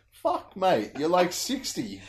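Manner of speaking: high, loud, slowed-down incredulous reply; laughing disbelief. Retold or imitated. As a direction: scold the old lady character in mock shock. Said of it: "And I'm like".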